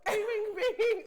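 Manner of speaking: high, loud, laughing catchphrase; Nene Leakes. Retold or imitated. Co-host quoting a reality star, high and loud. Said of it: "That's Nene Leakes".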